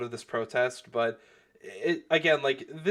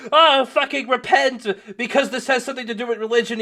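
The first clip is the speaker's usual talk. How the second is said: mock screaming; 9 semitones higher, 6 dB louder than usual. Protester demanding Vito repent over religion, mock screaming. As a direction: imitate a fervent protester, laughing at it.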